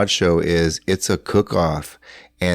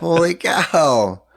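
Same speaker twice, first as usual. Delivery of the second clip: amazed; 11 semitones higher, 4 dB louder, much slower than usual. Real, not acted. Reacting to the drunk story.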